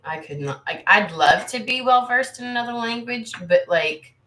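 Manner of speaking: ordinary talk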